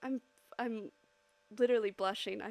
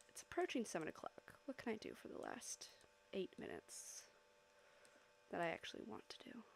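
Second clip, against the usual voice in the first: unvoiced whispered musing; whisper to self; 13 dB quieter than usual. Real, not acted. Streamer privately wondering what to draw, whispered. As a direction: whisper it to yourself. Muttering to herself.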